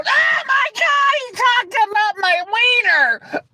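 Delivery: high, slow, wailing mock sob; high whining crybaby voice, hammed up; whiny mock crybaby. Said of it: "You didn't see him go".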